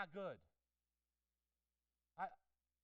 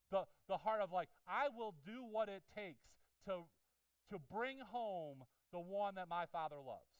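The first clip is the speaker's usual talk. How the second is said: louder, higher, slow sermon build; preacher's fervent swell; impassioned preaching; 4 semitones higher, 6 dB louder than usual. Acted. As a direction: plead the Father's heart.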